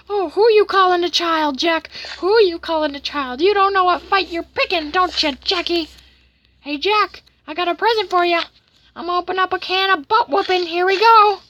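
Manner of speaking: high, loud goading character voice; hammed-up menace in a high voice; taunting cartoon villain. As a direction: taunt your rival before a fight.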